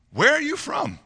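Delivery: high-pitched anxious question; afraid. A narrator's reading. Pilate questioning Jesus, afraid and high-pitched. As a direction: voice jumps up high, as if scared. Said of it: "he was even more afraid".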